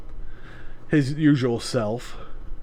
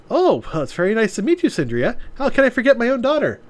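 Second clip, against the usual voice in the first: put-on young woman voice; 8 semitones higher, faster than usual. Voices Maya.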